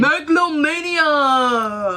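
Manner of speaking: booming slow announcer shout; megalomania. Acted. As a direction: proclaim it grandly, slowly. Announced title of coping method.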